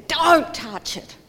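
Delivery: put-on mother snapping at her toddler near the heater; mum's sharp warning. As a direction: stop the child with a sharp cry. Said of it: "mum looks at it and says".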